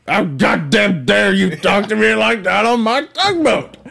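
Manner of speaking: outraged tugboat captain shouting; angry boat captain. Acted. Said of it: "bad impressions of a fat tugboat captain".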